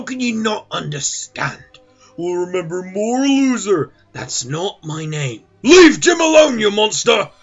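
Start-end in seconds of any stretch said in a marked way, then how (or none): 5.6-7.3 loud, high outburst; loud defensive shout; angry shout. Acted. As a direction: yell at the monster to back off. Defends Jim, shouting at villain.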